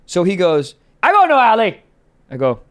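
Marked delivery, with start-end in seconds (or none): 1.0-1.8 louder, higher mock yell; retold yelling. Driver refusing the passenger's alley request, retold yelling. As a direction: blurt the refusal, loud and raised. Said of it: "Yelling, yelling at me".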